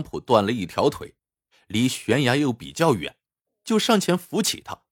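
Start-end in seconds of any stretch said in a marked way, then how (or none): none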